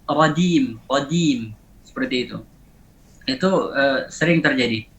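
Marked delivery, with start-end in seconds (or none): 0.1-1.6 "radim" with d in place of j; jim as dal. Shown as a demonstration. Teacher shows how rajim gets misread, d for j. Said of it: "misalnya rajim dibaca radi".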